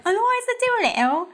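quick, high Cockney voice; Cockney impression. Shown as a demonstration. Host shows off her one good accent, playful Cockney. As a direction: rattle it off in high-pitched mock Cockney. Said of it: "kind of like a Cockney".

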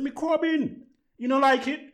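very high put-on Jamaican accent; fake Jamaican accent. Asking the girl if she likes it, fake Jamaican accent. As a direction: pitch it up in mock Jamaican. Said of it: "in my, um, fake Jamaican accent".

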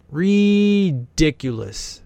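"Ridiculous" stretched very slowly, voice lifting; drawn-out ridiculous. Real, not acted. Commenter gawking at the mansion, drawn-out "Ridiculous". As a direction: draw out every syllable of "Ridiculous". Marveling at rich house.

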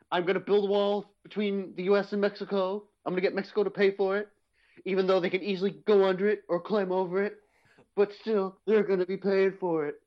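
a hint of Trump bluster; Donald Trump impression. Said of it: "How do you do a Donald Trump voice?".

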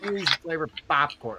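imitated Rhode Island accent. Co-host echoing the popcorn eater, imitated Rhode Island accent. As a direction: echo her Rhode Island accent quietly. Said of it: "I love the accent".

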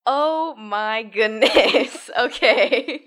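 slow, drawn-out words breaking into laughter; amused disbelief. Real, not acted. Daughter reacts to being grilled about tripping father, laughing. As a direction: stretch it out, then laugh through it.

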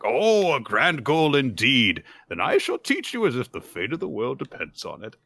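high, swooping, impressed tutor voice; hammed-up lofty approval; grand teacher. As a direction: proclaim it like a delighted old-school tutor. Retold teacher grandly praises child's goal.

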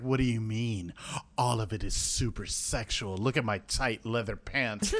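low, hushed vampire showing off; head vampire. Retold or imitated. Head vampire defends his sexy ways, low and hushed. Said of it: "the head vampire is like".

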